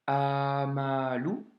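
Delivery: slow "amalu" with drawn-out vowels; stretched syllable-by-syllable demo of "amalu"; long A. Shown as a demonstration. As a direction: hold the first A of "amalu" long. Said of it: "c'est un A long".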